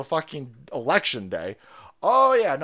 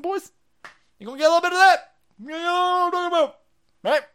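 high, loud gleeful threat; menacing tough guy; 12 semitones higher, 7 dB louder than usual. Acted. Threatening convicted traffickers in a high gleeful tough-guy voice. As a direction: relish the threat like a movie heavy.

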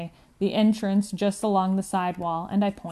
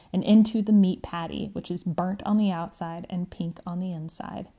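ordinary delivery

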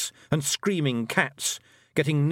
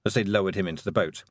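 ordinary delivery, nothing marked

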